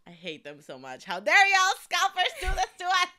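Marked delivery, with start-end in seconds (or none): 1.0-3.1 loud, high comic indignation; mock outrage. Fan berating ticket scalpers, playfully outraged. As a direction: cry out in half-laughing protest.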